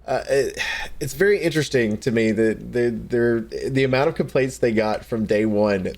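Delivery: usual speaking voice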